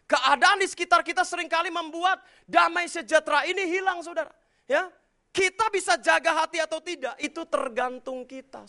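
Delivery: loud, high preacher's cry; preaching shout. Real, not acted. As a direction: cry it out to the congregation. (seruan khotbah yang lantang)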